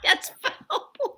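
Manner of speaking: high squeaky giggles; giggling. Real, not acted. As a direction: laugh helplessly, high. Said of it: "it's funny".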